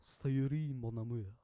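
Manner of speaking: hammed-up French accent; loud mock-French endearment. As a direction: put on a playful French accent. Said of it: "say it in a French accent".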